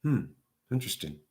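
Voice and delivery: a hushed, knowing aside; quiet knowing hmm. Retold or imitated. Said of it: "I go just like this".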